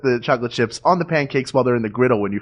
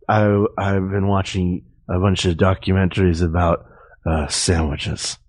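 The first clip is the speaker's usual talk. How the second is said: low, slow, weary drone; tired co-host impression; 6 semitones lower than usual. Teasing the co-host about their phone call, mock tired voice. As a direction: drag it out low like someone worn out. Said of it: "Is that what I sound like when I'm tired?".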